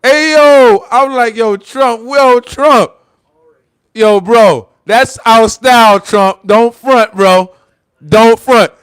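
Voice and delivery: hyped up. Real, not acted. Hollering to the president about his roast, hyped up. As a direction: call out in amused disbelief.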